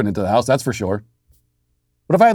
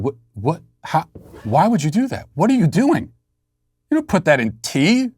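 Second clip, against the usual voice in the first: higher, shocked and aghast, retelling the moment; incredulous outburst; 5 semitones higher than usual. Retold or imitated. Husband retelling his shock at wife ruining bourbon, aghast high outburst. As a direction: replay your disbelief at what she did.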